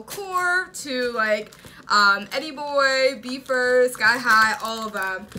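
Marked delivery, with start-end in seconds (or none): none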